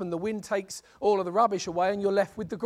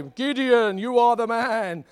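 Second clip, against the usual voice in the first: mock booming voice; 6 dB louder, slower than usual. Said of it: "big booming voice out of the clouds".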